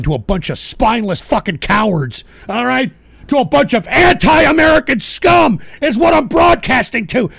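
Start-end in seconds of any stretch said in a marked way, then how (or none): 3.3-7.3 raised, loud, disgusted tirade; angry shouting. Real, not acted. Host berates the audience he broadcasts to, angry shouting. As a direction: shout it with contempt. Insulting his own audience.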